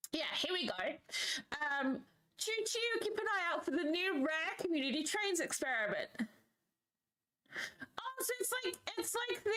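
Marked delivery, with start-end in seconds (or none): 2.4-3.0 playful choo choo. Pretend. Playful train noise. 6.2-9.5 high excited. Real, not acted.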